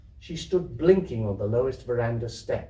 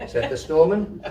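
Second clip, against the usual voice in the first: ordinary delivery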